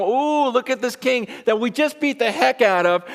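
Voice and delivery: high-pitched mock admiration; hammed-up jeering awe. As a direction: imitate soldiers sneering at a fake king. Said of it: "they're totally mocking him".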